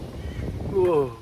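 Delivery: sudden high, loud whoa as the fish drops off; real shock, caught off guard; startled whoa. Real, not acted. Angler losing his fish at the net, startled whoa.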